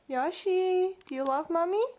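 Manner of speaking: high, sing-song pet voice. Real, not acted. Owner cooing to her pet bird, high baby talk.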